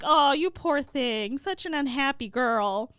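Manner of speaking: gushing sympathy. Acted. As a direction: coo over a poor friend, hammed-up. Friends pity the orphaned girl.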